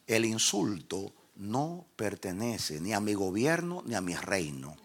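slow, soft divine pronouncement; voice of God. Retold or imitated. God correcting him about insults, slow and soft. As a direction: state the law softly and deliberately as God. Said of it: "Dice Dios".

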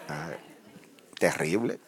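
ordinary talk

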